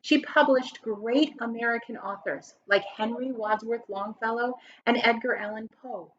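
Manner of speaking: ordinary talk, nothing marked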